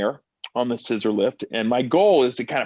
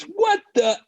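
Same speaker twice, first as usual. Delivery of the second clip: much higher, slow mock outrage; hammed-up angry director, pitched way up; imitating yelling director; slower than usual. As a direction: play the director tearing into his stuntman, drawn out and high. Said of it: "I'm getting yelled at by the director".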